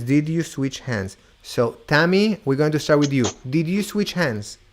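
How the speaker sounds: ordinary talk, nothing marked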